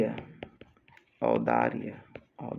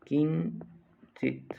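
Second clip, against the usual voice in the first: slow, higher-pitched किंचित; syllable by syllable; 4 semitones higher, 4 dB quieter, much slower than usual. Shown as a demonstration. Teacher dictating to students, किंचित slowly in two parts. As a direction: split किंचित into "kin" and "chit".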